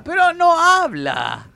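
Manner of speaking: remate agudo, lento y fuerte, en tono de burla; burla exagerada. Retold or imitated. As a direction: estira la frase en un chillido burlón. Burla del dicho del mate. (burla chillona del dicho del mate que no habla)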